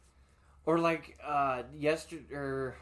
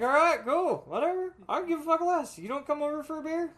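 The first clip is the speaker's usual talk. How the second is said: dismissive shrug; 11 semitones higher, 3 dB louder than usual. Retold or imitated. Quoting dismissive self.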